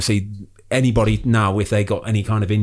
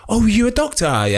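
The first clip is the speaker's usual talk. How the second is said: much higher, sing-song mock surprise; mock surprised stranger; 11 semitones higher than usual. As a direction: play the nosy stranger who just found out, pitched high. Mimics new people meeting doctor.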